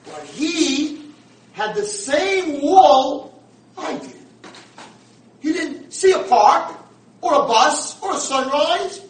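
high, loud and slow; impassioned cry. Retold or imitated. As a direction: proclaim it in awe. Old man's speech at funeral.

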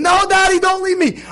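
high-pitched imitation of a panicking kid; mock child's scream. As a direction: act out a kid crying out to Daddy. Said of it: "the kids start screaming".

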